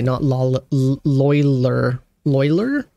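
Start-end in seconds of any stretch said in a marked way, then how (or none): 1.0-2.0 "loiler" slowly, as LOY-ler; "loiler" drawn out, rhyming with boiler; English pronunciation. Shown as a demonstration. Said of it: "Would that be the English pronunciation". 2.3-2.9 "Loiler" as LOY-ler, rising; questioning "Loiler", rhyming with boiler; English pronunciation. Shown as a demonstration. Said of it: "Would that be the English pronunciation".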